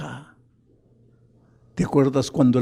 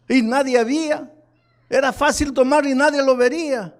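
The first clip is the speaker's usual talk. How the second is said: high-pitched, forceful pulpit delivery; impassioned preaching; 10 semitones higher, 3 dB louder than usual. Acted. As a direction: preach it high and urgent, driving each phrase home.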